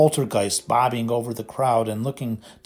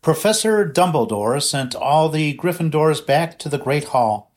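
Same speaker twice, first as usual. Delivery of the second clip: ordinary delivery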